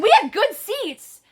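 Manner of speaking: animated outrage, punching the grievance; offended. Retold or imitated. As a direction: retell it worked up and offended. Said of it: "I was offended, bro. I was so mad".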